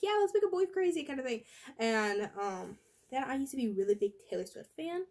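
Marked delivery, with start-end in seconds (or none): 0.0-1.4 higher, quick excited reply; quick, raised, hammed-up enthusiasm; mock eager younger self. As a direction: play your boy-crazy younger self, fast and bright. Her younger self answering eagerly.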